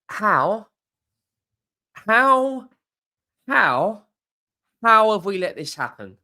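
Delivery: high, loud, pleading how-how-how; the fan is gutted that a rival stole the signing; anguished outcry. Real, not acted. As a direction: cry out in football despair. Fan despairs over lost transfer.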